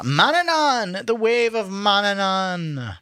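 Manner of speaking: high, slow and booming; grand dramatic reading. Acted. Friend reading Irish poetry aloud, grandly drawn out. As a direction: declaim the sea god's name like a bard.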